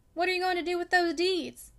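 mildly irritated, demanding question; sharp questioning. A narrator's reading. Irritated father demanding to know about his deeds, sharp questioning. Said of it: "in his ordinary tone of sharp questioning whenever he was irritated".

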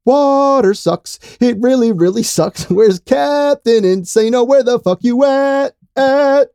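very high, loud, slow goofy voice; Waterboy impression. Quoting The Waterboy for his cohost, very high and loud. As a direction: squeal it like the Waterboy.

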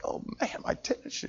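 unvoiced, under-the-breath lament; whispered thought. Patient whispering sadly about his cut-off tennis shoe. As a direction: mutter it to yourself in a whisper.

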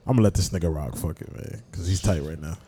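low, quiet resigned muttering; hushed, low give-up aside; resigned mother. Retold or imitated. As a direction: imitate a mom letting it slide. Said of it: "She be like".

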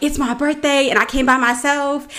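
imitating an earnest plea; retold plea. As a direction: plead your case like a birthday girl. Retells her plea to fans.

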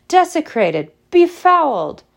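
high, loud outraged hiss. A narrator's reading. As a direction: spit the word out, scandalised. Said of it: "she hissed".

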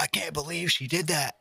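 high, hushed mock-shocked voice; clickbait voice. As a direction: gasp it like a scandal teaser. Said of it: "be like".

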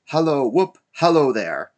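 loud, high hallos; joyful whooping. A narrator's reading. Scrooge whooping on Christmas morning, loud and joyful. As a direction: call out with a whoop of glee.

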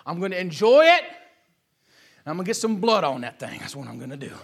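wicked carnal mind. Acted. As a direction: relish the sin like a sly schemer. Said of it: "that's my wicked carnal mind".

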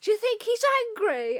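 high, loud retold shout; mock shout to the supervisor, high and loud; shouting across the room. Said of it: "I remember shouting to my supervisor".

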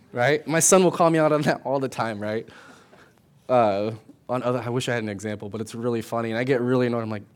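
ordinary talk, nothing marked

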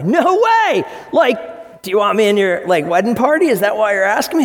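surfer-dude disbelief, yelled; excited shout. Retold or imitated. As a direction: shout it like a stunned buddy. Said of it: "And you're like".

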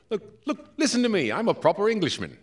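a hint of a posh Englishman; mock proper British. Improviser showing his failed generic British accent, mock proper English. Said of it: "I just try and talk properly".

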